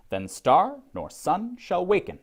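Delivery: verse line with STAR, SUN and WAKEN lifted; overemphasized stresses. Shown as a demonstration. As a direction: recite in a clear iambic beat, stressing "star" and "sun". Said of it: "I'll overemphasize".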